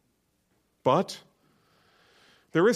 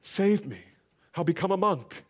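higher plea to Jesus; half-hearted imitation of a cry to Jesus; mild cry; 7 semitones higher than usual. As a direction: lift the voice a little in a lightly voiced appeal. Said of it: "why didn't he cry out".

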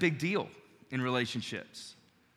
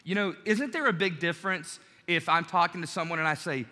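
ordinary delivery

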